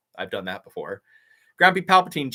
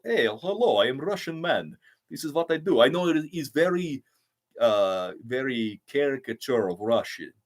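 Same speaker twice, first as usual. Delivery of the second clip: Russian mob boss. Shown as a demonstration. Streamer showing fans his caricature Russian accent, playful Russian mob boss. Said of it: "my Russian accent, though, is, like, Russian mob boss accent".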